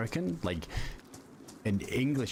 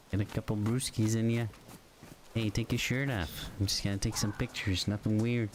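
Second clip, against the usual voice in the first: higher, hammed-up Canadian accent; Canadian accent impression; 4 semitones higher than usual. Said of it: "that sounds, like, kinda Canadian".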